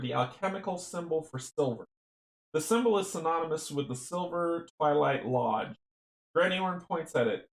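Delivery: ordinary delivery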